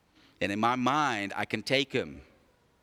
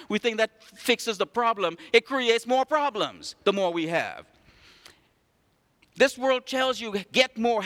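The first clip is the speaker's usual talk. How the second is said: raised, ringing pulpit voice; full-force preaching; 7 semitones higher than usual. Acted. Pastor preaching to the church, raised and ringing. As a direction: preach it out to the congregation.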